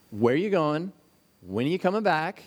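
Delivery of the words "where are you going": ordinary talk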